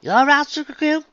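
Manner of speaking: put-on worried Southern farm girl. A narrator's reading. Applejack voiced, country drawl.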